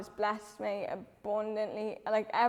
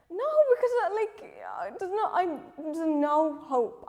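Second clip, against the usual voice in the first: pleading that there's no hope, voice cracking high; retold despairing protest; 7 semitones higher, 4 dB louder than usual. Telling her ma there's no hope, high strained despairing protest.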